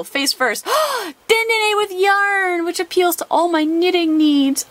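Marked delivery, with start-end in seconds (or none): 0.7-1.2 sharp delighted high gasp; quick thrilled intake of breath; excited gasp. Real, not acted. Excited card reveal gasp. 1.3-4.6 higher, slow and doting; gushing delight. Real, not acted. Gushing over cute card.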